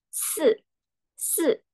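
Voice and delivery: "se" high and falling fast; fourth tone. Shown as a demonstration. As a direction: drop "se" sharply from a high start. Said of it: "dengan nada keempat".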